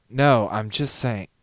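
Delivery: firm protest; insistent. Acted. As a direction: push back hard on each word. Styx insisting in argument.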